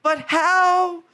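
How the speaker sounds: put-on high, dopey lover boy; mock Orpheus. Orpheus answering Eurydice in a high mock voice. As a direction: play him clueless and sweet. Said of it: "And he's like".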